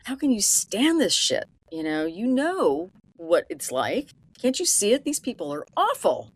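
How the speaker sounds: exasperated, pitch jumping high on the questions; pissed off. Retold or imitated. Friend fed up with a woman's behavior, pissed off. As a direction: challenge them in mock outrage. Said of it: "she is pissed off".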